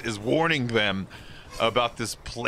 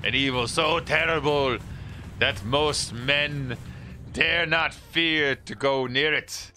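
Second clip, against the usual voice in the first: slow, grand doom-laden wizard voice, rising toward the end; spooky wizard warning; 2 semitones higher, slower than usual. Acted. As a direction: intone it like an ominous old wizard. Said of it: "What an eccentric performance".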